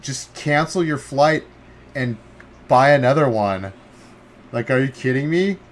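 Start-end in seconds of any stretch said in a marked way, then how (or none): 0.0-3.7 higher and louder, can't believe it; outraged disbelief. Real, not acted. Streamer ranting at a player who quit for a flight. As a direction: rant in indignant disbelief.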